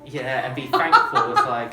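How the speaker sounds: laughing hard through the words; loud, slowed by laughter. Real, not acted. Joking with fellow presenter about Tiny Tim, laughing.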